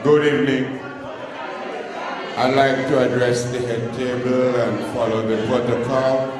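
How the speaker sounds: usual speaking voice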